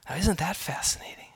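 hushed, fast whispered aside. Real, not acted. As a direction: lean in and whisper the wonder. Aside on a striking word.

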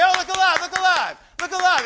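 loud, high call of look alive over and over; shouted coach's call from the dugout; coach yelling from the dugout. Retold or imitated. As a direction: bark it across the field to wake the players up. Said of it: "your coach does it, say".

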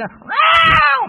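sudden piercing high scream; screeched movie noise. Retold or imitated. Preacher imitating Pet Sematary noise, loud high screech. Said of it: "I won't do that noise again".

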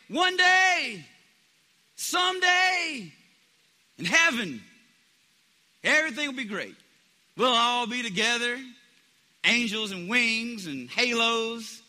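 preacher parodying someday-faith Christians, loud and high; mock rapture. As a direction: ham up dreamy heavenly hope.

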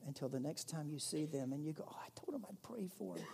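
hushed mock realization; whispered aside. Preacher imitating someone who forgot to pray, whispered. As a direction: whisper it like a guilty memory. Mocking forgetful promiser.

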